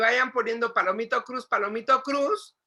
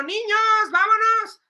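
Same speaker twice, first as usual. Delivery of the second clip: put-on loud, high call to faraway kids; calling out loudly, under control; raised voice; 10 semitones higher, 6 dB louder, slower than usual. Shown as a demonstration. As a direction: call the children across a park. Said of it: "tienes que levantar la voz".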